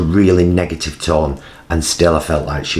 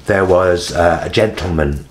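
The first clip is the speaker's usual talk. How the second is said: ordinary talk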